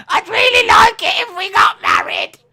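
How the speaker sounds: high, loud mock pleading; silly imagined groom voice. Groom pleading to get married, silly high voice. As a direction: plead in a squeaky put-on shout. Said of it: "his voice is like".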